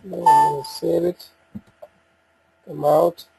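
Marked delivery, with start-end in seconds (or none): none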